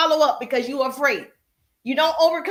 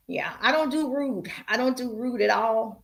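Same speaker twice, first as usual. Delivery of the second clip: normal talking voice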